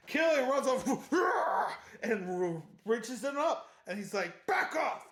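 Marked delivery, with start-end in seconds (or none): 4.5-5.1 high, sharp retold warning; hammed-up confrontational outburst; mock angry warning. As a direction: imitate a jealous man stepping in. Acts man breaking up encounter.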